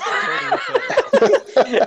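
slow, laughing self-mockery. Real, not acted. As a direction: laugh the words out slowly. Self-mocking joke while laughing.

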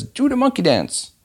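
very high-pitched crowd call; mock audience heckler. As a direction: call out a silly request from the audience. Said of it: "Then someone says".

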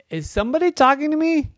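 high, fast timid eel; lightly voiced nervous eel. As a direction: ask it like a startled little eel.

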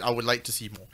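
a hint of a moody Kristen Dunst; understated low, hushed movie-star line; breathy actress voice. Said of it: "What are you, Kristen Stewart".

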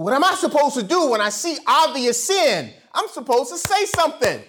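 high, driving pulpit delivery; full-force preaching. Real, not acted. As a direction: preach it with rising fervor.

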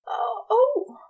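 high gasping cry of pleasure; breathless cry. Acted. Angel crying out high in pleasure. Aziraphale moaning at the touch.

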